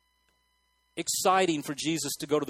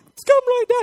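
very high, much louder cry; mock pleading prayer; 10 dB louder than usual. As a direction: squeal it for the sufferer's sake. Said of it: "they're going".

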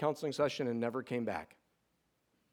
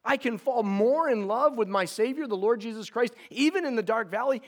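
amazed wonder; 7 semitones higher, 3 dB louder than usual. Retold or imitated. Son marvelling at his dying father's faith, higher and amazed. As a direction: marvel that it can be done. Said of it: "battle raging inside of me because I'm like".

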